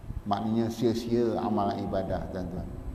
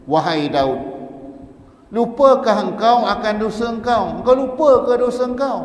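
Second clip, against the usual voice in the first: much louder and higher, faster at the end; angry rebuke; 11 semitones higher, 9 dB louder than usual. Acted. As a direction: roar the rebuke at Daud. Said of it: "dijawabkan dengan katanya". (tengkingan marah yang sangat lantang)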